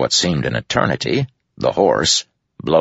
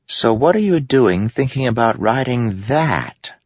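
Moash voice; 8 semitones higher than usual. A narrator's reading.

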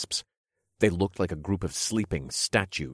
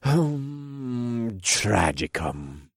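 sly villain king; 5 semitones higher, much slower than usual. Acted. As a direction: purr it out like a smug king. King casts spell.

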